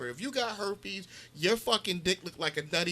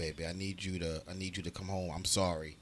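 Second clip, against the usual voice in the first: deep, smooth crooner voice; 8 semitones lower than usual. Acted. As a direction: croon a low plea to a lover.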